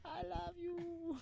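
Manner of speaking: high slow syrupy coo; drawn-out squeaky declaration; high slow mock voice.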